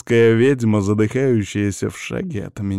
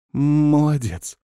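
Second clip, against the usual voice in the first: slow, higher drawn-out praise, a touch hoarse; 7 semitones higher, slower than usual. Acted. Witch praises her patient after the bullet, slow hoarse praise. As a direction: praise him huskily and slowly. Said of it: "хрипло похвалила она".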